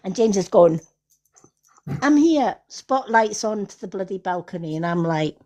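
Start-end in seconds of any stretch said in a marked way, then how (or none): 2.0-2.6 high eager call. Retold or imitated. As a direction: call up to the stage, a bit louder and much higher. Boy calls out from balcony.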